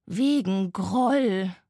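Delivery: very slow, drawn-out groan of an engine; groaning locomotive. A narrator's reading. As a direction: groan the dreaded name slowly. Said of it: "ächzte die Lok". (ächzende Lok, langgezogen)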